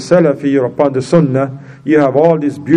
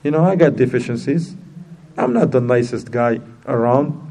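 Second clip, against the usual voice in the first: ordinary talk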